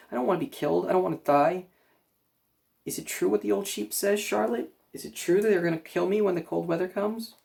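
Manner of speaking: high anxious begging; frightened pleading. A narrator's reading. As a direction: plead with rising fear.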